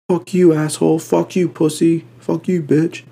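imitating men trading insults; mock cursing yell. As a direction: fire off the curses like a rowdy guy. Mimicking men cursing each other.

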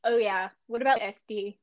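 ordinary talk

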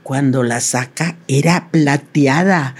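ordinary delivery

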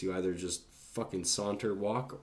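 ordinary delivery, nothing marked

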